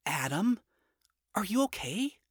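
timid concerned question. Acted. Charlie asks worried.

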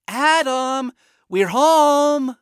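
called from the next room; loud, high call from off; from another room. Acted. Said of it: "A voice from the other room".

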